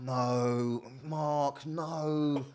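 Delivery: high, slow mock-exasperated mother's voice; mock scolding mum. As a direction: play a shocked mum telling her son off. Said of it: "she went".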